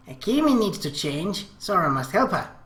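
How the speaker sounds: lightly voiced coy girl; a hint of a pleading little sister; girlish Akemi voice. Little sister asking Sora to help her change, lightly girlish.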